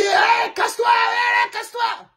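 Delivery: high shouted "casse-toi"; mock yelling fans. Mimicking adult fans yelling at Neymar's house, high and shouted. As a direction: bellow at the player's house. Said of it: "pour crier".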